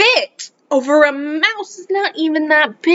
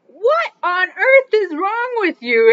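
startled question; 8 semitones higher, slower than usual. Retold or imitated. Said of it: "I was like".

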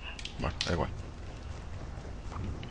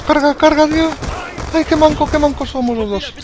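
much higher, loud frantic yelling; excited shout; 6 dB louder than usual. Real, not acted. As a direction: yell it in gaming panic. Reloading panic, then self-mockery.

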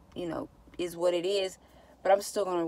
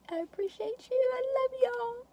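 sweet goodbye. Real, not acted. High sweet goodbye to viewers.

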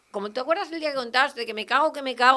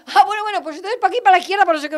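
higher, louder, fussy directions; imitating the nurse; 6 semitones higher, 7 dB louder, faster than usual. Imitating the nurse giving directions, higher and louder. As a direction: rattle off the directions eagerly.

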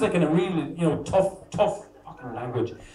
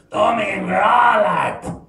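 slow, loud, hammed-up Irish declaration; hammed-up Irish lover; 5 semitones higher, 6 dB louder, much slower than usual. Irish guy waking beside a Polish woman, slow loud mock declaration. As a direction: bellow it slowly like a rough morning-after lover. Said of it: "this guy turns to you and goes".

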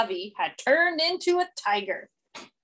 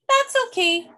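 much higher, brighter reassuring dad voice; dad comforting his son, pitched up high; cheerful dad; 13 semitones higher, 5 dB louder than usual. A narrator's reading. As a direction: reassure the little one. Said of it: "said Dad".

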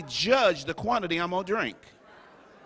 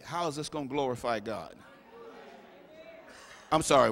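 usual speaking voice

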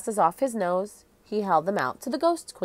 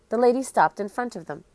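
ordinary delivery, nothing marked

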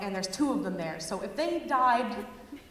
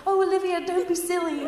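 put-on higher sweet, coaxing kids' voice; posh British kids; 7 semitones higher, 4 dB louder than usual. British boys coaxing their babysitter Olivia, high and sweet. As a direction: coax her like polite British boys. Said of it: "being like".

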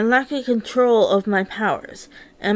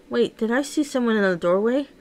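ordinary delivery, nothing marked

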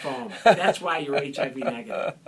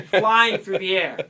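slower, louder, savoring the image; louder, drawn-out storytelling; 3 semitones higher, 10 dB louder than usual. Retold or imitated. Comic tells the radio host the climax, louder and slower. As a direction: build it big and slow.

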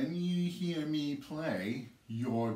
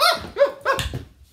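high burst of laughter; 12 dB louder than usual. Real, not acted. Laughing after the pop.

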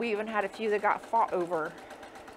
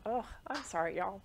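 apologizing through tears; 6 dB quieter than usual. Real, not acted. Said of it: "I need tissue".

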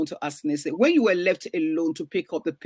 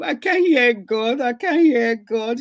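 retold crying; 4 semitones higher, 5 dB louder, slower than usual. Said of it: "I was crying and crying".

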